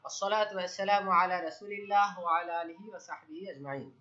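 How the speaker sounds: slow, measured recited praise; Arabic opening recitation. Real, not acted. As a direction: recite the opening formula with drawn-out vowels.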